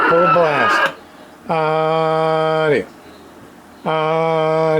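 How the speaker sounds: normal talking voice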